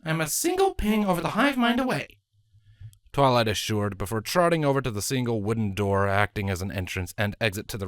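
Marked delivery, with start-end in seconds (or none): 0.0-2.2 Twilight's voice. A narrator's reading. Twilight's voice in a story.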